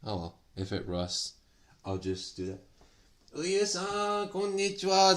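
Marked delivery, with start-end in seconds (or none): 3.3-5.2 bright excited greeting. Real, not acted. Streamer welcoming viewer Uyu on stream, excited hello. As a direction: greet the viewer with a big lift in pitch.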